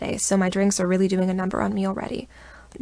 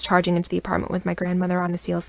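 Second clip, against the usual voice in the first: ordinary delivery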